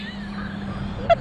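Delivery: high breathless laugh after a scare; relieved laugh. Real, not acted. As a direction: laugh off the fright.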